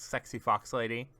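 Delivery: usual speaking voice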